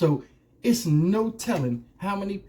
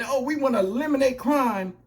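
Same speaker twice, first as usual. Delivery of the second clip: mock mayor; 6 semitones higher than usual. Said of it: "you get the mayor saying".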